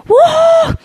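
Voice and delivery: a sharp, high cheering whoop; a real happy whoop. Real, not acted. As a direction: whoop for the audience. Cheering after thanks.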